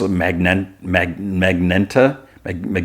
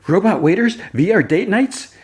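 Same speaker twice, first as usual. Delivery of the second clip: high, excited listing; Jimmy marvelling at the dating venue, high and eager; thrilled Jimmy; 13 semitones higher than usual. Retold or imitated. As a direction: sound delighted by every gadget.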